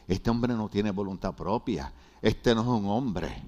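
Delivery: low, slow mocking mutter of strangers; lightly voiced scornful onlookers. Pastor voicing restaurant onlookers, low mock scorn. People's judging looks voiced.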